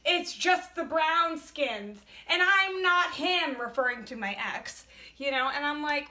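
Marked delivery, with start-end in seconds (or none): 0.1-3.6 mock yell, higher and louder, slow and heavy; retold rant. Retelling her ex ranting from the truck, mock yelling. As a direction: hammer out his rant like he yelled it. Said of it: "ranting and yelling on the phone".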